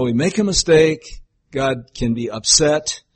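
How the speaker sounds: ordinary talk, nothing marked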